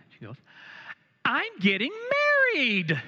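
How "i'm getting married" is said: high and loud, bursting with news; imitating an overexcited bride-to-be; giddy announcement. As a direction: gush it out like big news. Imitating the perky woman's news.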